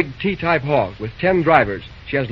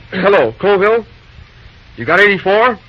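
louder, calling down a phone line; 3 semitones higher, 5 dB louder than usual. Acted. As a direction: raise the voice for a crackly line.

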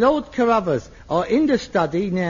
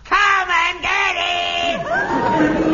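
booming shout on request; 7 semitones higher, much slower than usual. Shown as a demonstration. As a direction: bellow it again, even bigger. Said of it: "Such resonance".

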